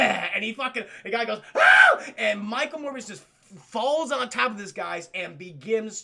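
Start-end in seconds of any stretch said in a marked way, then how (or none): 1.6-2.0 piercing high mock scream; a loud, very high hammed-up scream; victim's scream. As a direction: shriek like a startled victim.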